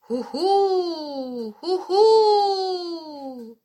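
langgezogenes, hohes Huhu; Eulenruf. A narrator's reading. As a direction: ruf wie eine Eule, lang ausklingend. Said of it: "rief sie noch von weit her". (heulender, lauter Eulenruf)

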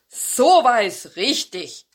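put-on a satisfied old dog testing his sharp teeth; old dog calling out. A narrator's reading. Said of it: "rief der alte Hund".

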